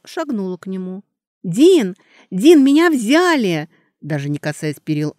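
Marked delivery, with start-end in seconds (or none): 1.4-3.7 high, loud joyful cry; excited shout. Acted. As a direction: call out overjoyed. Girl runs in with happy news.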